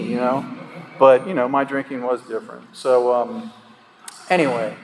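ordinary delivery

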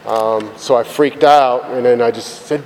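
usual speaking voice

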